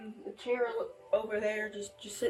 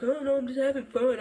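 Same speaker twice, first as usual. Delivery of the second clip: louder imitated brush-off; retold defensive excuse; 3 semitones higher, 6 dB louder than usual. Youth mimicking the man dismissing mom's scolding, defensive. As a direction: imitate someone loudly waving off complaints. Retells his excuse for pestering.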